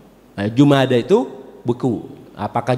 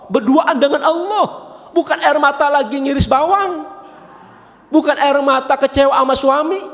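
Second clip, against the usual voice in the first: high, ringing preacher's voice; impassioned sermon delivery; fervent preaching; 10 semitones higher than usual. Acted. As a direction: lift the voice and drive the point home. Preacher rises to fervent climax.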